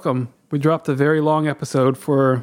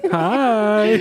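high flirty greeting; 4 semitones higher than usual. Retold or imitated. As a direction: mimic a flirty hello. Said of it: "such a flirty hi".

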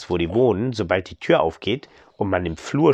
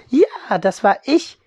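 mother; 12 semitones higher, 6 dB louder, slower than usual. Acted. Mother answers her children.

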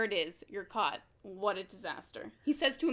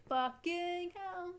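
mock shocked; 7 semitones higher, 4 dB quieter, much slower than usual. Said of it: "And you're like".

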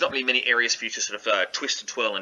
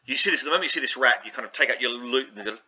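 ordinary delivery

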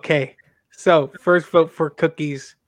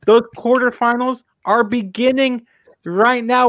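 announcer voice; 5 semitones higher than usual. Acted. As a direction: announce it like a show host. Host announces quarterfinals.

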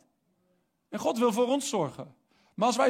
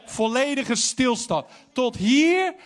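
slow, emphatic, louder preaching; 4 semitones higher, 4 dB louder, slower than usual. Acted. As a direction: hammer each word home slowly. Preek over zorgen stoppen. (langzaam en nadrukkelijk preken)

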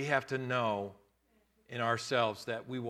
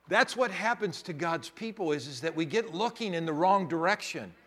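ordinary talk, nothing marked

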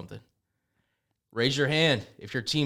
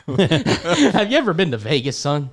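down south coach; 3 semitones higher, 4 dB louder, slower than usual. Pretend. Coach repeating himself to the recruit, slow laughing Southern pitch. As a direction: draw it out like a Southern recruiter, amused. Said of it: "most down south college football coach".

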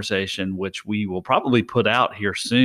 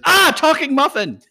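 high, loud, startled shriek, laughing; a muffin shocked that the other one talks; 6 dB louder than usual. Acted. Joke punchline, high startled muffin. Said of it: "The other muffin goes".

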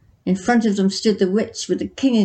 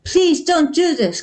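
high, louder desperate plea; pleading cry; 5 semitones higher, 3 dB louder than usual. A narrator's reading. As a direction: beg the witch to stop. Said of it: "cried a villager".

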